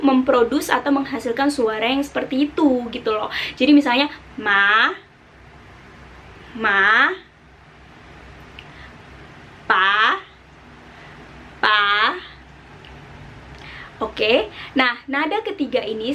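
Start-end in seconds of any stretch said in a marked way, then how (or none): 4.3-11.8 ma and pa rising; second tone, rising. Shown as a demonstration. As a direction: slide each syllable up. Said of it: "nada kedua kita itu adalah nada naik". (nada kedua, naik ke atas)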